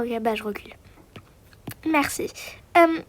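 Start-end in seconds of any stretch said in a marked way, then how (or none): 0.0-0.7 chuchoter presque sans voix; céder tout bas; murmuré. Acted. Murmure après la demande. (mari qui recule en murmurant)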